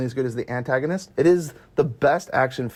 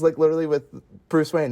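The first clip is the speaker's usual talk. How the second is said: usual speaking voice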